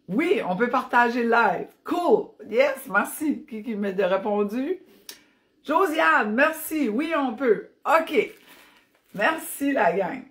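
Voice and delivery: high, loud burst of relief and thanks; delighted. Real, not acted. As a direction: cheer the answer, pitched well up. Delighted by a viewer's answer.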